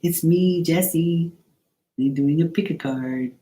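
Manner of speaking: high-pitched card reader impression; Jesse. Imitating Jesse introducing Pick a Card, high mimicry. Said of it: "I can do Jesse Pick a Card right now".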